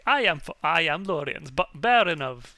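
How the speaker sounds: loud, hammed-up baron introducing himself; big put-on character voice; Lawrence. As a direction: announce yourself loudly, stumbling over the name. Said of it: "do you wanna take over Lawrence".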